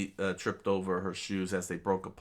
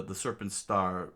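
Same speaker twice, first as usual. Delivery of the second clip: ordinary delivery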